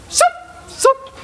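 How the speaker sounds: very high, quick "zoop"; short high-pitched magic zip sound; imitated wand zap. Storyteller mimics magician father's wand, high quick zaps.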